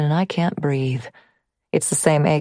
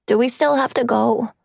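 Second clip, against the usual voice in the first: put-on child voice; 6 semitones higher than usual. Narrator voices the son.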